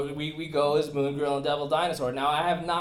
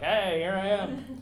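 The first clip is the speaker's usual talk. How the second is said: cheery greeting; 6 semitones higher than usual. Pretend. Wearing inflatable T-Rex, greeting people.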